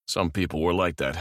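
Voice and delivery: ordinary delivery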